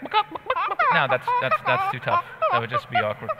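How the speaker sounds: high, slow squeaky voice. Pretend. Acting out chickens.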